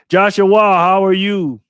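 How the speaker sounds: loud, high, drawn-out hello; stretched, full-voiced greeting; calling out greeting. Real, not acted. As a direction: holler a big welcome to a friend.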